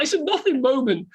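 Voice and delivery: ordinary delivery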